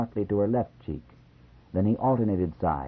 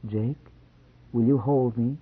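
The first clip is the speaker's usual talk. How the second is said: timidly; 4 semitones higher than usual. A narrator's reading. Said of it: "Then she said timidly".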